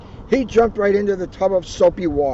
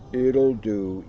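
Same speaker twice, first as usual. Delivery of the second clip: lower, glum donkey voice; Eeyore voice; 7 semitones lower than usual. Acted. Eeyore grudgingly approves of Tigger's mud, glum and low. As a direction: droop through it gloomily. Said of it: "Eeyore said".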